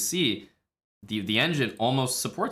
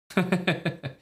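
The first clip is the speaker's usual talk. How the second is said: short laugh; 6 semitones higher than usual. Real, not acted. Laughing at engine evaluation.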